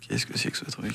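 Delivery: very low and breathless, under the breath; a baffled whisper to himself in the car; whispered thought. Retold or imitated. Inner thought in a strange car.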